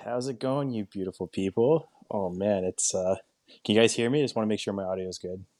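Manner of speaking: ordinary delivery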